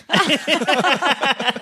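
high, squealing burst of laughter; high giggling squeal; delighted laugh. Real, not acted. Delighted at sister's compliment.

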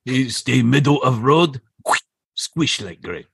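broken-English sensei impression; Mister Miyagi. Miyagi warning Daniel about the road, imitated sensei voice. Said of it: "scene from Karate Kid where Mister Miyagi".

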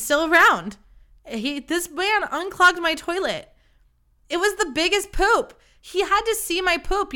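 high-pitched, emphatic disbelief; incredulous outburst. Real, not acted. Telling listeners he stayed despite the toilet disaster, incredulous. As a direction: build to the astonished climax of your own story. Incredulous climax of embarrassing story.

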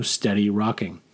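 usual speaking voice

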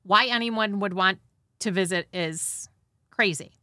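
ordinary talk

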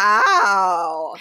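long, loud drawn-out gush; mock amazement. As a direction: play a whole crowd's exaggerated astonishment. Imitating everyone's reaction to makeup.

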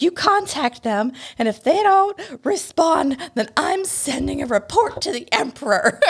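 high, huffy petulant threat; mock hissy fit. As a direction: play it as a spoiled tantrum. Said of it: "big hissy fit meltdown".

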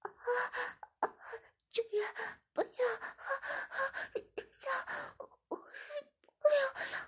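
high, rising desperate cries breaking into a sob; loud begging. Acted. Shiya gasping under the towel, loudly begging. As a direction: cry out for mercy, voice climbing. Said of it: "大声求饶起来".

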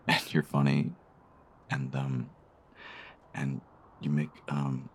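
ordinary delivery

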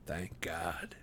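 breathless, hoarse whisper of relief. A narrator's reading. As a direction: rasp it out under your breath. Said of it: "he said hoarsely".

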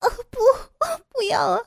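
high-pitched, tearful panicked pleading; terrified cry. A narrator's reading. Captive protests as she is carried off, terrified cry. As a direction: cry out in broken, high pleas. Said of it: "傅菊英惊恐地喊着".

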